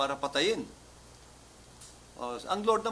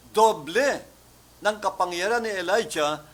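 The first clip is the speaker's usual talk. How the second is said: emphatic preaching; 4 semitones higher, 8 dB louder than usual. Acted. Preacher stressing the double.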